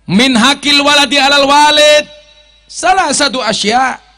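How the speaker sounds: loud, high Arabic recitation; hadith recitation. Acted. Preacher recites the Prophet's hadith to the congregation, chanting loudly.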